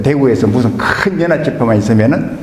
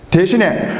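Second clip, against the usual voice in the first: ordinary talk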